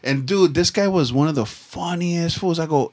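normal talking voice

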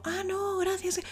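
high, soft refusal; polite refusal. Retold or imitated. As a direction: turn down gently, high. Rechazo imitado, voz aguda. (rechazo amable, agudo)

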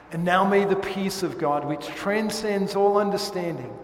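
loud, ringing pronounced blessing; solemn formal blessing; benediction. Acted. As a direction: bless the standing congregation. Pastor gives the benediction.

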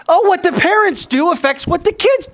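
very high-pitched excited discovery; acted amazed outburst; sudden realization. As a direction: blurt it out as it dawns, voice way up. Student grasps the meaning.

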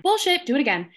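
high sharp bark then a command; mock professor shooting her down; professor's retort. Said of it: "professor rejects again".

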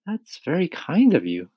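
put-on high-pitched boy's voice; polite James. A narrator's reading. James thanking the ladybug for her offer, high polite voice.

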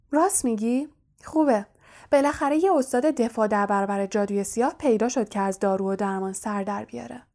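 ordinary delivery, nothing marked

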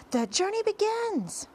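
very high, ringing announcement; triumphant shout. A narrator's reading. Centipede announcing the journey to his friends, high triumphant shout. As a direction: proclaim it with great excitement. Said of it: "shouted the centipede".

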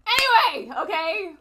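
sudden loud, high anyway to get back on track. Real, not acted. Pulling the chat back on track with a loud anyway. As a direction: shout it to cut the laughter. Loud restart after laughing.

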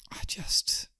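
breathless whisper; whispered thought. Acted. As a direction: whisper it to yourself. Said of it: "He thought".

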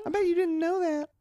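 high-pitched awkward store guy; squeaky oddball coworker voice; high character voice. Acted. Skit character Clint continues.

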